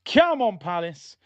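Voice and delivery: high, loud burst of encouragement; urging fan shout. Real, not acted. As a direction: shout the team on, pitch jumping up. Urging team on.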